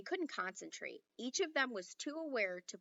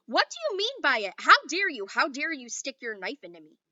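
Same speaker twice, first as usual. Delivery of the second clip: loud, higher angry demand; furious scolding; 4 semitones higher, 11 dB louder than usual. A narrator's reading. Omri scolding Little Bear, loud and furious. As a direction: tear into him like the headmaster. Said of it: "he hissed like Mister Johnson".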